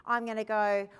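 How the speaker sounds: ordinary delivery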